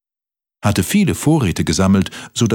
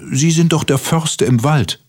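higher-pitched, polite cricket; pleading cricket; 8 semitones higher than usual. A narrator's reading. Cricket asks the stag beetle for shelter, pleading.

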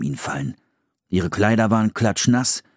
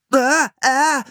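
high-pitched yelp; boy's startled cry; 4 dB louder than usual. A narrator's reading. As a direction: cry out as Philipp grabbing the eel. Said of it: "schrie er".

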